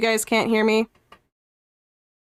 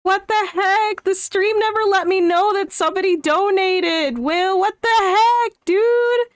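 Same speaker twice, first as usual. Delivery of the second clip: higher, indignant what the heck; playful outrage; 7 semitones higher than usual. Real, not acted. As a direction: squeak out mock outrage at the missed donation. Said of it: "I'm mad now".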